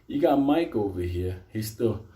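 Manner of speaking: ordinary delivery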